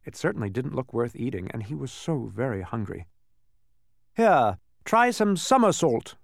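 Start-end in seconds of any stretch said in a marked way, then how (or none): 4.1-6.2 high, slow duke; slow high suggestion. A narrator's reading. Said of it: "suggested the duke".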